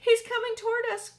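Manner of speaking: put-on high, loud wail of an old insect; wailing grasshopper. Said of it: "wailed the old green grasshopper".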